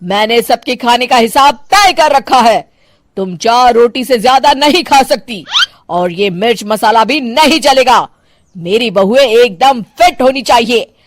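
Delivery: strict retired cop scolding her daughter-in-law; stern policewoman mother-in-law. Acted. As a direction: lay down the house rules like an officer.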